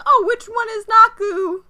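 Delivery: put-on high girly question. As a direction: squeal it like an excited visitor. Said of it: "they are like".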